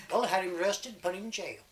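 ordinary delivery, nothing marked

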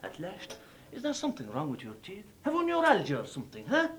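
soft, hushed question; quiet concern. Acted. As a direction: ask gently under your breath. Stranger asks quietly.

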